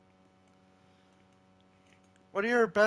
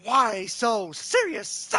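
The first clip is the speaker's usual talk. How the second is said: slow, drawn-out villain taunt; put-on comic-book villain voice; Joker; 4 dB louder, much slower than usual. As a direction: stretch out each word menacingly. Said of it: "do your best Joker impression".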